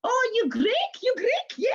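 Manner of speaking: put-on loud, very high, excitable Greek accent; hammed-up Greek accent, insistent questioning; imitated Greek lady. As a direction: play an eager Greek lady, pitched right up. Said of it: "this beautiful Greek lady grabbing my face and going".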